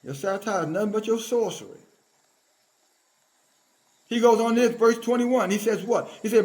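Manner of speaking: ordinary talk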